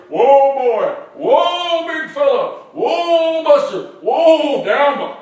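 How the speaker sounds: high, loud drawn "whoa" calls; hanging on. Acted. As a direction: holler to halt a bucking animal. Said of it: "I was hanging on for dear".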